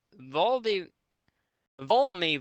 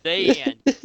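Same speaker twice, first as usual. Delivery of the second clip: grand finale; 11 semitones higher, 8 dB louder than usual. Pretend. Grand close of the story.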